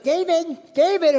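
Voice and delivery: put-on high, loud call of an old lady; cranky old neighbor calling. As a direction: mimic a grumpy neighbor calling his name. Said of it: "she comes out and she goes".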